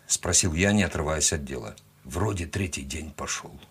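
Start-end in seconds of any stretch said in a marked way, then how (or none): 2.0-3.6 low, soft, resigned sigh; subdued, weary answer; sighed reply. A narrator's reading. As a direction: say it on a sigh, much quieter. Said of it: "вздохнул Степан".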